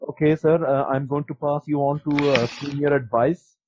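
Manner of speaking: put-on a light Indian accent. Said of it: "he's like".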